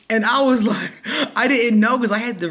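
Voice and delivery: giggling through the explanation; laughing. Real, not acted. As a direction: talk while still laughing. Laughing at the memory.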